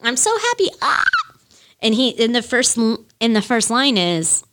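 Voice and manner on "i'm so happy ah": very high, gleeful mock squeal through the words; hammed-up overjoyed shriek; imitating happy singer. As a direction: squeal the words with childlike delight. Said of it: "like, really happy".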